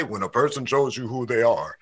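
deep voice. Retold or imitated. Quoting Madea's advice about a partner, deep voice. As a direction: drop it deep to quote Madea. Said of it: "that's a deep voice".

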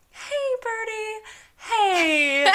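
high, slow, sing-song sweet talk; coaxing baby talk. Retold or imitated. Parents coaxing a trapped bird out, high cooing baby talk. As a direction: coo at it like a scared little pet.

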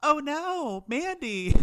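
high, loud mock gasp of dismay. Pastry chef wails at Mandy in hammed-up horror. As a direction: wail her name in hammed-up horror. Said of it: "She's like".

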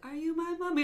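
put-on high-pitched English child voice; creepy little kid with an English accent. Host imitating gas-mask kid from Doctor Who, English child voice. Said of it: "But in England".

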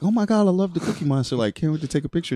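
high, fast, gushing excitement; excited fan. Retold or imitated. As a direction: gush like a starstruck tourist. Tourists gushing over Cookie Monster.

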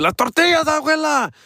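high-pitched mock alarm; alarmed calling out. Grandson warning grandma the tortillas are burning, alarmed calling out. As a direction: call out the warning, voice shooting up high.

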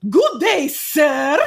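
mock posh gent, lifted and crisp; proper posh gentleman. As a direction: tip your hat like a stuffy gentleman. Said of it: "he sounds very proper".

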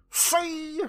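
very high, stretched squeal; mimicked dad. Co-host mimics Dad turning on Skipper, manic squeal. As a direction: imitate a manic father's gotcha. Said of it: "looks around at him and goes".

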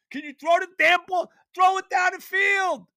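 high, loud shout at the quarterback; furious yell. Real, not acted. Fan yelling at his quarterback, furious shout. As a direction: yell it in disbelief at the screen.

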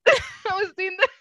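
can't hold back the giggle; laughing. Real, not acted. Laughing while retelling.

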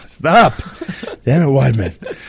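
high, loud, tearful self-scolding; crying. Real, not acted. Fighter telling himself to stop mid-story, crying. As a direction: scold yourself as real tears come. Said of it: "Damn it, you made me cry".

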